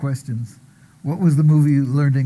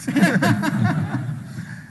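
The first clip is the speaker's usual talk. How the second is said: short laugh. Real, not acted. Host laughs at accent joke.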